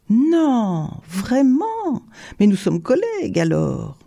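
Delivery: high, drawn-up exclamation of disbelief; delighted surprise. A narrator's reading. Tourba finds a fellow angler, delightedly surprised. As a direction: react with amazement, pitch leaping up. Tourba astonished to find a colleague.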